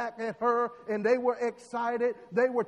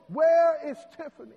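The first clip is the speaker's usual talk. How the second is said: sudden louder, higher worried call; alarmed parents; 5 semitones higher, 8 dB louder than usual. Acted. Parents notice Tiffany missing, louder alarmed call. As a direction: panic rising as they look around.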